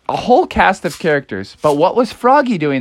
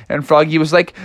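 usual speaking voice

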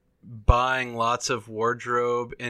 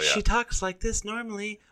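high, lighter mock voice; imitated normal voice; 10 semitones higher, 3 dB quieter than usual. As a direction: imitate her natural high-pitched voice. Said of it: "Well, see, she goes down".

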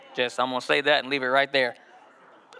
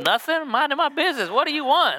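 higher, defensive and a bit snippy; caught-off-guard reply; 10 semitones higher than usual. Retold or imitated. Husband caught looking at photos answers higher and defensively. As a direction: snap back like you got caught.

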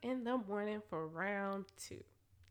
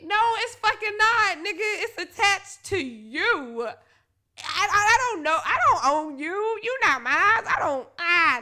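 indignant retort; 6 semitones higher, 9 dB louder than usual. Retold or imitated. Arguing back at partner.

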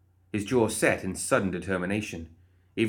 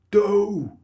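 nasal broken-nose voice; 12 semitones higher, 8 dB louder than usual. Acted.

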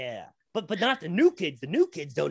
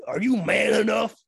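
wrestling promo; 5 semitones higher, 4 dB louder than usual. Acted. Challenging him to a fart off.

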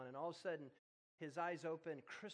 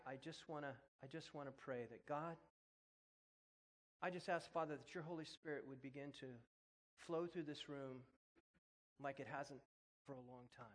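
spoken prayer. Real, not acted. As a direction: pray over the room. Leads congregation in prayer.

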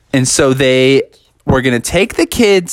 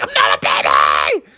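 put-on screeched little-kid outburst; freaking-out Junior; 10 semitones lower than usual. Junior snapping at the mocking employees, screechy tantrum. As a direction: shriek it, losing all control. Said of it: "And he freaked out".

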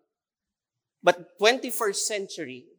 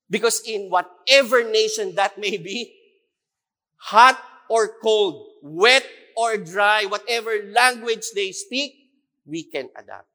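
rousing preaching; 4 semitones higher, 8 dB louder than usual. Acted. Preaching about Filipino adaptability.

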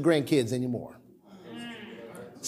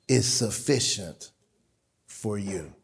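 lower, much softer and slow; reverent hush; 8 semitones lower, 8 dB quieter, slower than usual. Acted. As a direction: read God's answer gently. God's answer, reverent reading.

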